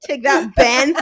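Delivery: loud playful taunt. Real, not acted. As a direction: shout it at him teasing. Taunting teasing.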